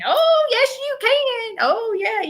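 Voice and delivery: cheering grandmother. Retold or imitated. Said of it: "you remember her saying".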